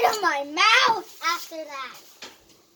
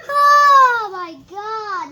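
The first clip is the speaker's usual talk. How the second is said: drawn-out exasperated; 3 semitones higher, 8 dB louder, much slower than usual. Real, not acted. Slow loud groan of relief.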